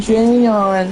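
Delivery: ordinary delivery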